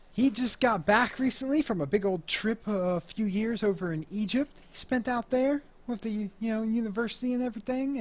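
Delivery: a hint of a proud introduction; club host talking up. Club boss telling the crowd about his brother's trip, light emcee voice.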